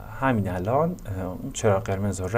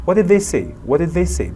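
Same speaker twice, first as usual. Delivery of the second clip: high, louder question dropping on "say"; falling intonation; 6 semitones higher, 5 dB louder than usual. Shown as a demonstration. Teacher repeating a what question, pitch falling on "say". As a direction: start high and let "say" fall. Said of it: "the intonation falls at the end".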